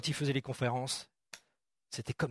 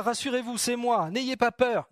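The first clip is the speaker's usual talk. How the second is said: reassuring call; 7 semitones higher, 4 dB louder, slower than usual. Acted. Jesus reassuring disciples on water.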